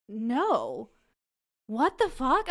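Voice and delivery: loud, indignant refusal; retold outburst, jumping high and loud; acted outrage. Woman to man in her bed, loud outrage.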